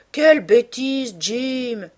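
high, loud, drawn-out mild reproach; sighed reproach. A narrator's reading. Sister brushing off her brother's excuse, sighing reproach. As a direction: stretch it out in fond exasperation. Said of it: "soupira-t-elle".

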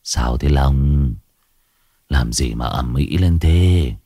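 low, slow, ailing voice; sick groaning man. Acted. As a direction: speak like a feverish man in bed. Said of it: "nằm rên hừ hừ". (giọng ốm yếu, trầm và chậm)

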